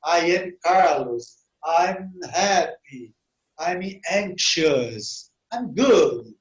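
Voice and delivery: very slow, measured English model sentences; slow pronunciation examples. Shown as a demonstration. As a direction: let each short example sit for the learners. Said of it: "Esse I que você tá falando de mim". (exemplos em inglês bem devagar e pausados)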